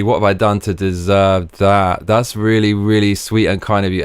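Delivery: ordinary talk, nothing marked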